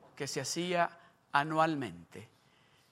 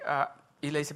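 ordinary talk, nothing marked

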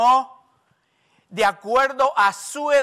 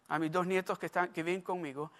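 ordinary delivery

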